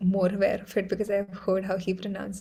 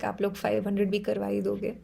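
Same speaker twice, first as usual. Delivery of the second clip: ordinary delivery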